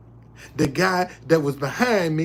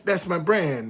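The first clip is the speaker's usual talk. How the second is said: usual speaking voice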